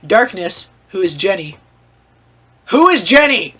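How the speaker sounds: ordinary delivery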